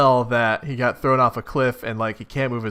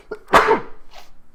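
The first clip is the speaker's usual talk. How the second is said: sudden high sneeze burst; a real sneeze. Real, not acted. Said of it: "Sorry, I had to sneeze".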